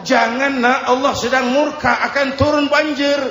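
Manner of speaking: lightly acted father calling out a warning; ayah memperingatkan. Father urgently warning son of flood. (peringatan ayah bernada tinggi)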